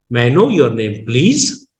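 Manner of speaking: human-sounding question, pitch swoops up on "know"; tone variation. Shown as a demonstration. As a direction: rise on "know", drop for "your name", rise on "please". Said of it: "we use tone variation".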